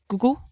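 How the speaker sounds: "Google" said as "guggle", short vowel; "Google" as GUH-gul, imitating dad; dad's mispronunciation. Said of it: "as my dad likes to pronounce it".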